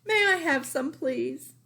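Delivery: put-on high little-girl plea; pleading child. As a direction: ask sweetly like a small child. Child voice asking.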